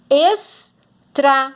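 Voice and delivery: Estrada stretched very slow, es-tra-da, each syllable apart; slow syllable split. Shown as a demonstration. Teacher showing pupils how to pronounce it, slowly by syllables. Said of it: "Olha como a gente pronuncia".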